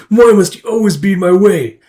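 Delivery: loud, high mock complaint; frustrated outburst. Agent thwarted by a man present, frustrated outburst. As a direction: burst out at a rival who blocks you.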